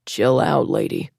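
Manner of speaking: low, rough hushed voice; scratchy whisper. Acted. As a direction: rasp it out under your breath. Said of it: "the man said in a scratchy whisper".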